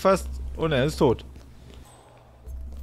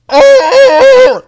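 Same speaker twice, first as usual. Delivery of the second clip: a sudden very high, loud yelp; startled cry; 16 dB louder than usual. Real, not acted. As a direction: caught off guard, it jumps out.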